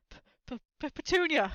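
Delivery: stuttering, then a loud high cry; gasped stammer. A narrator's reading. Uncle in shock calling to his wife, stammering then crying out. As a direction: choke on the name, then blurt it. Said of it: "he gasped".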